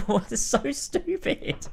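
laughing disbelief. Real, not acted. Laughing at the game.

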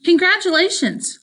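high, pointed congratulations; snide congratulations. Acted. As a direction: say it with a sharp sting.

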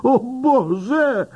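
bardzo wysoki, spanikowany okrzyk; wrzaśnij w panice na widok szarżującej świni; przerażony wrzask. A narrator's reading. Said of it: "wrzeszczy gliniarz". (policjant ucieka przed świnią, wrzeszcząc ze strachu)

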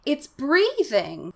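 high, slow, drawn-out amazement; astonished cry. A narrator's reading. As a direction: stretch out the startled discovery. Boy's alarmed discovery, read aloud.

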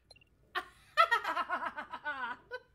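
loud rising villain laugh; a Disney cat villain laughing loud and long; villain cackle. Acted. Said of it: "Disney Cat Villain".

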